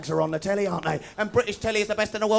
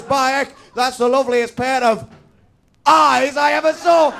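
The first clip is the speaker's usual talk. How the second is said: northern bloke; 4 semitones higher, 4 dB louder than usual. Retold or imitated. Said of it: "amicable northern stereotype".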